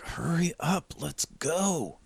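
high, hushed urgent call; loud stage whisper. Acted. Player whispering across a hundred feet, hushed and high. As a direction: try to whisper across a distance. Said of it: "Trying to whisper across".

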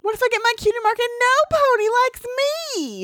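very high anxious filly; panicky filly voice. Acted. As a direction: spiral into high worried questions. Voicing anxious Apple Bloom.

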